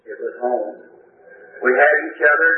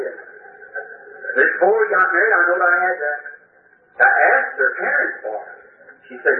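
ordinary delivery

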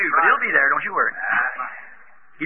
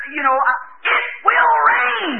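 much higher, very slow and drawn out; slow insistence; 11 semitones higher, slower than usual. Retold or imitated. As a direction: stretch every word with firm faith. Daughter-in-law answered by Noah.

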